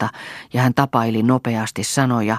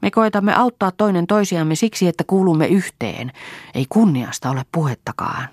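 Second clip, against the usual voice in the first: earnest, raised-pitch reproof; mildly stern lecture to a sister; 6 semitones higher than usual. A narrator's reading. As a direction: put a stop to the joking, firmly. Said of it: "sanoi Lea ankarasti".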